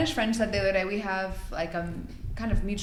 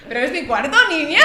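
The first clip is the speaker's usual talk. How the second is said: mock Andalusian lilt, higher through a laugh; Andalusian accent; 7 semitones higher, 7 dB louder than usual. Retelling a row over a room, playful Andalusian accent. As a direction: imitate an Andalusian, lifting the last word high and loud. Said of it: "Eso suena muy andaluz".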